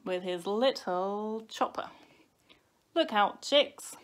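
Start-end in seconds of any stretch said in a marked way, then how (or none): 2.9-4.0 higher, louder mock shout; shouted warning. A narrator's reading. Baby wolf to chicks before chopping, shouted warning. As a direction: call out a playful threat. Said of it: "he shouted".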